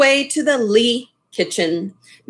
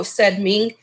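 ordinary delivery

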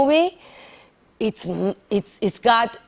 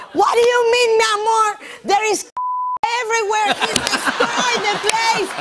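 husband yelling; 11 semitones higher than usual. Retold or imitated. Husband yelling at wife about the destructive duck. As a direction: shout about the mess, hammed up.

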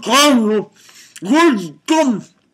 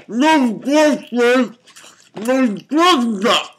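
slow lament; slower than usual. Real, not acted. Lamenting the missing girlfriend.

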